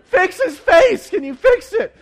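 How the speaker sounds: hammed-up panic, near crying; panicked dad pleading with the ER doctors, high and loud; frantic young dad. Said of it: "I'm like".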